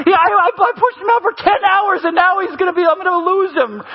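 a hammed-up mother yelling over her son; mock yelling mother. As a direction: play the frantic mother, hammed-up. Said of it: "Your mother's yelling".

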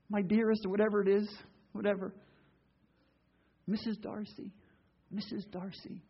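soft, hushed lovey-dovey voice, lightly done; mock romantic tenderness. Preacher mocking a movie couple's sweet talk, softly cooing. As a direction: coo it like a movie lover, half joking.

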